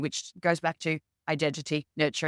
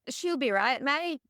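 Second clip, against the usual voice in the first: broad, higher Australian drawl imitating relaxed PE staff; mock laid-back Australian accent, pitched up; 7 semitones higher, slower than usual. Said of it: "in true Australian fashion".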